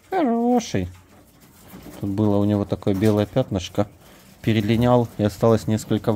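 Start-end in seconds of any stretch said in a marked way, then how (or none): 0.1-0.9 much higher, sing-song praise; high cooing praise. Real, not acted.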